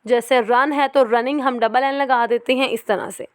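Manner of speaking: ordinary talk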